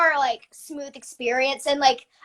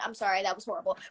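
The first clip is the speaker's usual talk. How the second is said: ordinary talk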